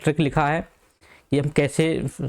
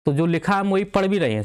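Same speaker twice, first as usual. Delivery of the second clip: ordinary talk, nothing marked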